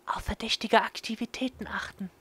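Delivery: put-on high, hushed girl voice; conspiratorial whisper. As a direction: whisper the plan secretly. Yuko whispering, narrator voiced.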